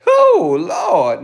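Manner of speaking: ordinary delivery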